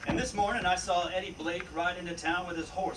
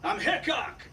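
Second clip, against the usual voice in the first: calling out; 8 semitones higher than usual. Acted. Marshal calling up to outlaw.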